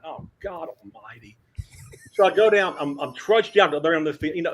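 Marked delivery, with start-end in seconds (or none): 0.0-1.4 muttered dread. Retold or imitated. Dreading aside, "I'm like.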